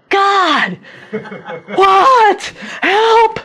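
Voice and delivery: a secret, comic plea for help; mock panicked cry. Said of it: "in secret he's going".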